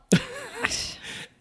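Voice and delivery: high-pitched burst of laughter; a high squealing laugh. Real, not acted. Laughs loudly.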